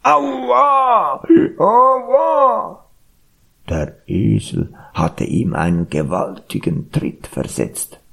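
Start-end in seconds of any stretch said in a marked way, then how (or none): 0.0-2.9 high-pitched acted yelp of pain; hammed-up howl of pain; ox crying out. A narrator's reading. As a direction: yell as if just kicked, drawing it out. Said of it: "dann plötzlich schrie der Ochse".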